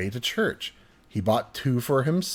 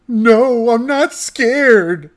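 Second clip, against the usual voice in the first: much higher, loud, slow sobbing no; crying kid denial; 10 semitones higher, 9 dB louder, much slower than usual. Retold or imitated. Boy sobbing at dad that he is not scared, high wail. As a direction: wail it like a hurt six-year-old.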